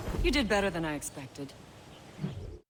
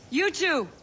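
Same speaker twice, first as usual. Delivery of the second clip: sharp call, higher and a bit louder; 9 semitones higher, 4 dB louder than usual. Acted. Teacher calling two recruits over, loud call. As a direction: summon them across the yard.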